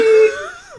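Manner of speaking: high, loud villain cackle; evil cackle. Pretend. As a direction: cackle like a cartoon baddie. Villain laugh after line.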